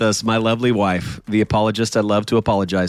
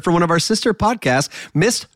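high, drawn-out guest introduction; playful announcer; 7 semitones higher, slower than usual. Pretend. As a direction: announce the name like a mock ring announcer. Host introduces guest with fanfare.

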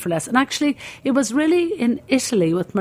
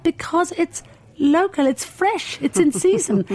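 higher, slow, patient stallholder; 10 semitones higher, slower than usual. Retold or imitated. The stallholder explaining to a puzzled tourist, higher and slow. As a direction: spell it out like a patient market seller. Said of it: "And he said".

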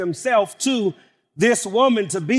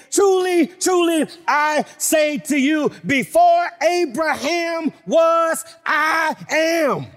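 higher, ringing proclamation; preaching at full force; 10 semitones higher, slower than usual. Acted. Preacher quoting Jesus to the congregation, high ringing proclamation. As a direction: declare it from the pulpit, full voice.

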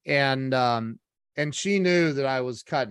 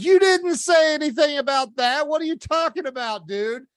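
high, loud retold outburst; indignant protest; 11 semitones higher, 6 dB louder than usual. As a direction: protest in disbelief, pitch way up. Retold quarrel with the salesman.

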